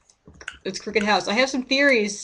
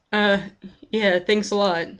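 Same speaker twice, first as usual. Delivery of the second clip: normal talking voice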